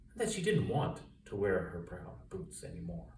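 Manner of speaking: ordinary delivery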